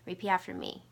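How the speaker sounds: ordinary delivery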